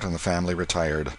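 ordinary delivery, nothing marked

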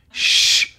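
long, sharp "shh"; librarian shushing. Acted. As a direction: hush the room like a librarian. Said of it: "my impression of a librarian".

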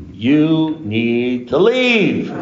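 very slow, high, drawn-out words; exasperated insistence. Retold or imitated. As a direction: stretch each word, pitch climbing, to get through. Same advice, escalated, to sponsee.

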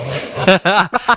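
real amused laughter. Real, not acted. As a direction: burst out laughing at a friend's quote. Amused at friend repeating "now.

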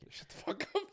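voiceless mock whisper; under his breath. Man cursing underneath his breath at the drive-thru, whispered. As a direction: whisper it as a private curse. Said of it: "underneath my breath".

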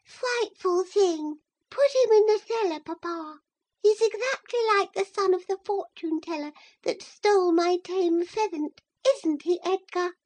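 put-on a spoiled child squealing to her father; lisping girl. A narrator's reading. Girl begs her papa to lock him up, lisping high. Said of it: "Isabella lisping".

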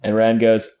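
usual speaking voice

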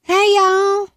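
high, slow Southern drawl; imitated Southern accent. Mimicking people who imitate Southerners, drawn-out Southern accent. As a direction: stretch out a mock Southern drawl. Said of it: "try to imitate Southerners".

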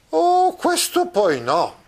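very high, slow, drawn-out protest; Don Quixote exclaiming. Acted. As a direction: cry out in outrage at the mistake. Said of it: "esclamò a questo punto Don Chisciotte". (esclamazione acuta e lenta di Don Chisciotte)

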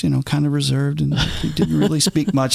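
ordinary delivery

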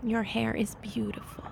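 softly whispered compliment; tender whisper. Acted. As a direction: whisper it lovingly. Tender reassurance to partner.

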